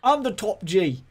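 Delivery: hammed-up Tate impression in mixed English accent; mock Andrew Tate accent. Mocking Andrew Tate's bragging in his mixed English accent. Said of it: "what is this accent".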